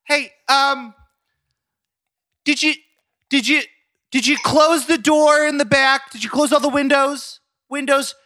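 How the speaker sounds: high, fussing mother voice; put-on high-pitched mom, halting and insistent; impression of his mom. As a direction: nag your son as an anxious mom. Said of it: "your impression of your mom".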